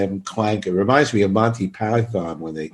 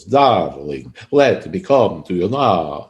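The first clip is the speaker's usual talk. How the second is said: put-on Russian accent; 2 semitones lower, slower than usual. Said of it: "almost like Lugosi accent".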